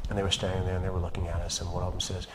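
ordinary talk, nothing marked

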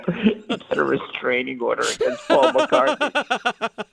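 high, slow, laughter-broken speech; laughing through words. Real, not acted. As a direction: crack up at your own joke while saying it. Joking while laughing.

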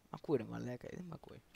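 ordinary delivery

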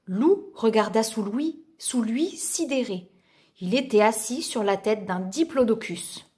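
ordinary delivery

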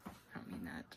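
whispered take-back; whispering. Acted. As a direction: whisper it quickly. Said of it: "That was mean".

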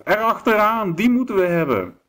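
high, loud rallying yell; wolf shout. A narrator's reading. Werewolf rousing the wolves after the buffalo, high shout. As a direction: shout the pack into the chase. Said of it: "schreeuwde de woewolf".

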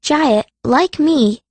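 ordinary delivery, nothing marked

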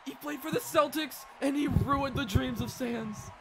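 high, loud victory call; big, stretched play-by-play shout; excited commentary. Real, not acted. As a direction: belt it out like a hyped sports announcer. Hyping the winner live.

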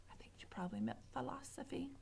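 soft, fast whisper to the reader; whispered aside. Real, not acted. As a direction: confide the joke under your breath. Narrator aside.

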